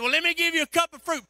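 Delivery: loud, high call into the dark. Retold or imitated. Preacher answering the patient in a dark room: loud, high call. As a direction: call over to him, keeping it friendly.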